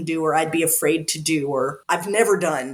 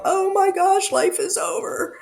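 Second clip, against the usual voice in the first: higher, hammed-up wail of doom; mock despair; 11 semitones higher than usual. Describing her own explosive emotional moments, mock melodramatic wail. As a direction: play the drama queen outburst. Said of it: "these explosive".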